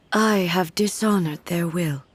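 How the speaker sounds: ordinary delivery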